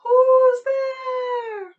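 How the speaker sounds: very high, loud hooting call; owl crying out. Acted. Owl in the battered elm tree, crying out. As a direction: hoot the words out long and steady. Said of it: "the owl is crying out".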